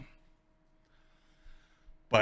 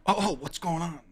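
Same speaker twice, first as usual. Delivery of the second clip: higher-pitched imitation of startled bystanders; mock freaked out; 6 semitones higher than usual. Bystanders reacting to the guard's warning, mock freaked-out voice. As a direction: lightly act out a panicky question. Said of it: "they're all freaked out".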